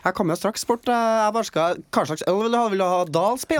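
high-pitched Trøndersk; trøndersk dialect. Pretend. Flight attendant asks passenger about beer, high Trøndersk. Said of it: "Trøndersk".

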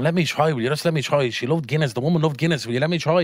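high-pitched, sing-song acted begging; insistent pleading. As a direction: argue back, imitating the desperate uncle. Uncle insists over deathbed, retold.

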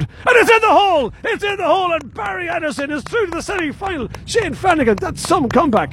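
exploding into a jubilant yell; excited shout. Real, not acted. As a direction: roar it as the putt drops. Putt drops, commentator erupts.

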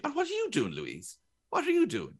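high-pitched mock scolding. One person giving out to another, mock scolding. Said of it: "in that tone of voice".

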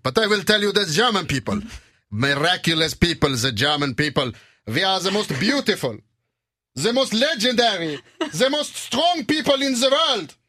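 German orator building to a high shout; German accent. Shown as a demonstration. Mock German leader praises his people, rising accented rant. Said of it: "Så pratar alla tyskar".

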